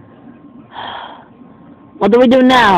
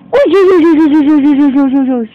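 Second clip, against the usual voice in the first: magic whoosh noises; 4 semitones higher than usual. Pretend. Magic being cast.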